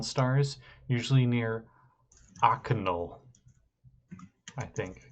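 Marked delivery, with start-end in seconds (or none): 2.4-3.2 Akanul said as AH-kuh-nul; tentative pronunciation. Shown as a demonstration. Game master trying out a place name, tentative pronunciation. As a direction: stress the open first syllable of Akanul. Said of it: "is how that's pronounced".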